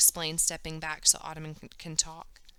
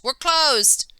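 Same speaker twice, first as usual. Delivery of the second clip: a loud, higher closing-time shout; hollering; 7 semitones higher, 10 dB louder than usual. A narrator's reading. The shopkeeper tells everyone they're closed, loud holler. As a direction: shout it across the store to end the day. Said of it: "Mister Wong hollers".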